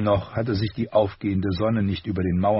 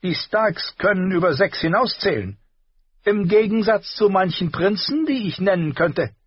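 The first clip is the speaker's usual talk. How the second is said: higher, louder dry retort; Tyrion retorting; 12 semitones higher, 4 dB louder than usual. A narrator's reading. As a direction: cut the nephew down politely. Tyrion insults the prince.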